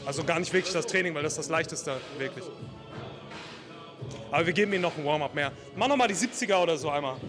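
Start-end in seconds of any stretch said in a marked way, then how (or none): none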